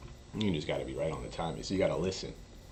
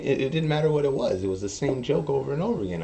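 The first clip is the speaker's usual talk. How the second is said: ordinary delivery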